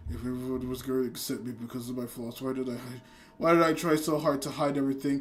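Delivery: low, hushed inner monologue; quiet self-questioning. Retold or imitated. Character questioning her hidden flaws, low and subdued. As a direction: sink into a low, soft, brooding murmur.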